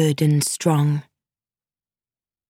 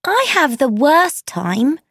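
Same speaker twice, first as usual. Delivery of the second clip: higher-pitched outcry of grievance; crying out in complaint; 7 semitones higher than usual. A narrator's reading. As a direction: cry it out as an aggrieved complaint. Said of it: "cried Amy".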